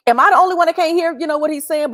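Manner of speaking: high, fast, bewildered; high-pitched rushed disbelief; confused inner thought. Retold or imitated. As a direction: sound baffled, like you alone can't hear. Retold confusion at quiet teacher.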